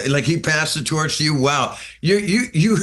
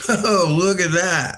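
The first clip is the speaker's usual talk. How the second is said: mock gawking; 6 semitones higher, slower than usual. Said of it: "and we're going".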